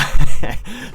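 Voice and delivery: short loud laugh at himself; real laugh breaking out of the bit; embarrassed laugh. Real, not acted. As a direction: burst out laughing, a bit sheepish. Laughs at own bad accent.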